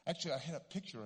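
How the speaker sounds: usual speaking voice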